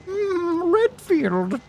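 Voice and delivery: very high, drawn-out jester voice; Alfred Ashford. Shown as a demonstration. Streamer attempting a long-unpractised impression, shrill jester voice. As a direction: squeal it out like a crazed falsetto jester. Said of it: "amazing jester voice with his Alfred Ashford".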